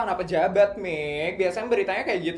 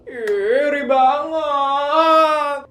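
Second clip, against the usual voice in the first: high, slow, drawn-out envious whine; 7 semitones higher, 6 dB louder, much slower than usual. Acted. Friend whining to Mika that she is jealous. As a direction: stretch out the envy.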